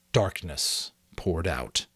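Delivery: normal talking voice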